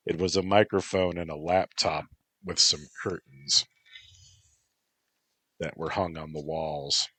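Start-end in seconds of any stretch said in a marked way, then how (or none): none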